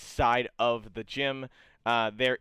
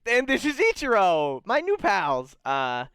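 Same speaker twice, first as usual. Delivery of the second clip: higher, brisk friendly pitch; cheery guide; 9 semitones higher, 4 dB louder than usual. Acted. Guide introducing friends and praising the shopkeeper's weapons, high cheery. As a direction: talk up the shop. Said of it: "guide presents party and shop".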